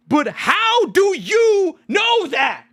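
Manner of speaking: slow, high, drawn-out yell; incredulous shout. Real, not acted. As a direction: demand proof, exasperated. Ranting at a TikToker.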